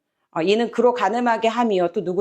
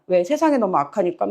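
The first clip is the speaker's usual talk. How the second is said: usual speaking voice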